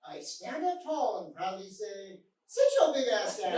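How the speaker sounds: recited like a grand poem, laughing through it; poem recital. Acted. Comic flight attendant reciting his poem, hammed-up. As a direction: declaim it with mock pride.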